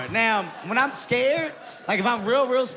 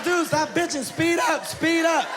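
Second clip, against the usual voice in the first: friend yelling; 9 semitones higher, slower than usual. Retold or imitated. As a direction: shout at him to floor it. Friend yelling at scared driver.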